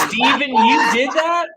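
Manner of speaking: loud, high-pitched disbelief; real astonished yell at a friend; incredulous outcry. Real, not acted. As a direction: shout it in amused shock. Incredulous reaction to the story.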